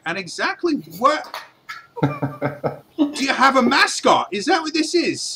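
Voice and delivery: baffled dwarf. Acted. Dwarf baffled at the party's cat, incredulous high voice. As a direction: do a double take out loud.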